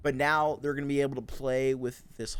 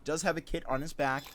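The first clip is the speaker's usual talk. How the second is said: esports caster; 6 semitones higher than usual. Acted. Live esports play-by-play.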